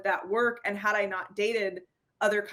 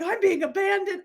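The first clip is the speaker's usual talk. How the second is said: high, dramatic mock alarm; mock panic; 11 semitones higher, 4 dB louder than usual. Woman voicing her anxious thought, high mock alarm. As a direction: play the panic big.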